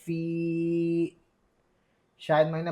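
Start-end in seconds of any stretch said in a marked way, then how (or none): 0.0-1.2 फी held on a long steady ee; drawn-out फी. Shown as a demonstration. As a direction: stretch फी right out. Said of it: "खींच कर पढ़ें". (फी को खींच कर पढ़ना)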